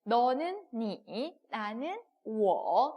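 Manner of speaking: slow, drawn-out teaching read; slow model reading; drilling vocabulary. Shown as a demonstration. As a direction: stretch each word out for learners to memorize. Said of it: "같이 계속해서 기억을".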